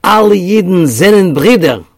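loud, high Yiddish declaration; loud Yiddish call; declaring in Yiddish. Retold or imitated. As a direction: proclaim it out loud to strangers. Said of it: "in Yiddish he declared".

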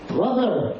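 high, sudden cry of recognition; screamed. A narrator's reading. Girl in the dark room calling to her brother, a high scream. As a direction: shout the word out high. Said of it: "And she screamed to him".

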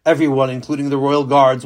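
ordinary delivery

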